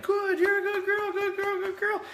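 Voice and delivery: very high, gushing dog praise; extra excited praise. Shown as a demonstration. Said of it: "I can give her a little bit of extra excitement".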